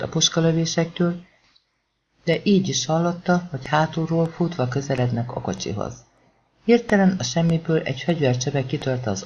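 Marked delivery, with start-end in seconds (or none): none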